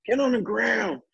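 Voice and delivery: high-pitched imitation of a yelling cop; mock yelling. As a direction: mimic a panicked officer shouting orders. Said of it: "Now he's yelling".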